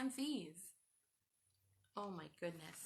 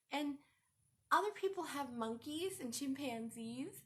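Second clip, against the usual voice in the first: usual speaking voice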